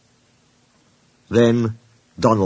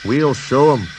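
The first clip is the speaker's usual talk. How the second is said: grim; 3 semitones higher than usual. A narrator's reading. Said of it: "said Douglas grimly".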